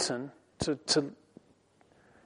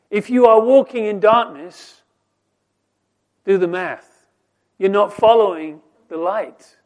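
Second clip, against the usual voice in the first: louder, higher, direct challenge; pointed preaching; 4 semitones higher, 10 dB louder than usual. Acted. Preacher challenges anyone walking in darkness, pointed and raised. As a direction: put it to them straight.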